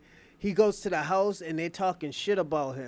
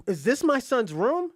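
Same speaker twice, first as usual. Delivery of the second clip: higher, louder retold disbelief; mildly indignant demand; 6 semitones higher, 5 dB louder than usual. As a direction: act out an affronted father, lightly. Acts out father confronting butler.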